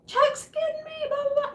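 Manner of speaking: very high squealing cry for help; mock yelling. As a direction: imitate a panicked yell, way up high. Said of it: "she's yelling for Dale".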